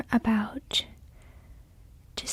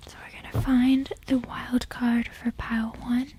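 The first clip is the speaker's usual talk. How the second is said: whispering. Real, not acted. ASMR tarot whisper.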